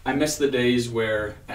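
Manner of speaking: ordinary delivery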